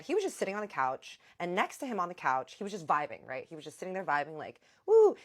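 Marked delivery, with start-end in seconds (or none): 4.9-5.2 high, loud whoop; playful whoop. Retold or imitated. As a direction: let out a playful mock cheer. Acting out the vibing man.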